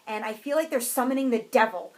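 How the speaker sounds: ordinary talk, nothing marked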